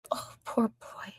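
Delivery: slow mocking whisper of pity. Real, not acted. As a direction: breathe it like fake sympathy. Mocking him for crying.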